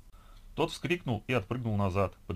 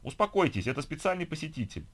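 higher-pitched official calming a crowd; exasperated registrar; 7 semitones higher than usual. A narrator's reading. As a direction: hush the complainers firmly. Registrar quells the protesting queue.

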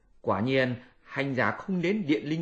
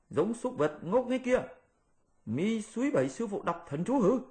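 high scolding monkey voice; high-pitched mock scold; Monkey King scolding; 9 semitones higher than usual. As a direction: tell off the foolish pig.

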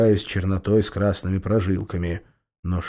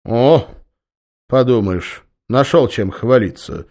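snide; 4 semitones higher, 8 dB louder than usual. A narrator's reading. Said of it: "ехидно ответил он".

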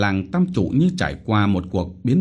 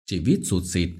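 plain reading voice

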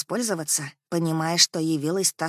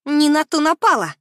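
sly triumphant; 9 semitones higher, 8 dB louder than usual. Acted. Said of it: "Расплылась я в коварной улыбке".

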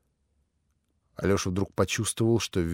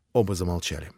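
ordinary delivery

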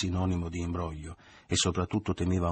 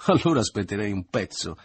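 loud mocking retort; raised voice, forced amusement; scornful false hilarity; 3 semitones higher, 7 dB louder than usual. A narrator's reading. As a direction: scoff loudly at an absurd proposal. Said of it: "Una risata alta, forzata, sprezzante".

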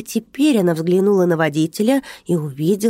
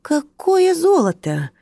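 puzzled high, slow question; genuinely taken aback, higher and slow; surprised; 10 semitones higher, slower than usual. A narrator's reading. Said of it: "удивлённо проговорил тот". (удивлённо, высоко и медленно)

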